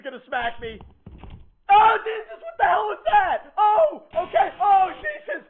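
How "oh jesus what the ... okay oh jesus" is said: scared horror game streamer. Real, not acted.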